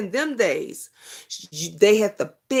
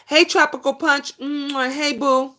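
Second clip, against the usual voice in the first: higher, louder sing-song hello to a viewer; excited greeting; 7 semitones higher, 4 dB louder than usual. Real, not acted. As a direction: greet her brightly and blow a kiss.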